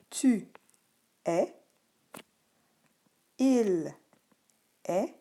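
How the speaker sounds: long pauses between each word; isolated, carefully voiced words; word-by-word dictation. Shown as a demonstration. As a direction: dictate slowly, one word at a time. Teacher dictating verb forms.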